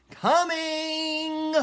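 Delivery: high, loud shouted answer. A narrator's reading. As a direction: yell back. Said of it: "shouted Annie again".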